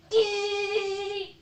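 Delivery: high, piercing mock alarm "Dee!"; imitated alarm beep. Imitating the emergency door alarm going off, high beep. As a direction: mimic the shrill alarm that won't stop. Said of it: "was just going like".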